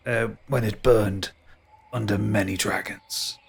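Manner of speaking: slow, low musing tree spirit; lightly voiced ancient tree, mournful. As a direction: draw it out like a forgetful ancient oak. GM holds tree monologue.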